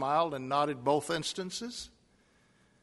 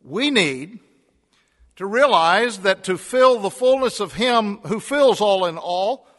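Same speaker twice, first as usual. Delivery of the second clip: louder, higher, each "we've got to" hammered home; rally the congregation like a revival preacher; full-force preaching; 5 semitones higher, 10 dB louder than usual. Acted. Preacher urging his congregation to be the church, full-force preaching.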